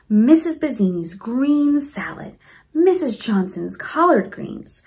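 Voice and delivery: plain reading voice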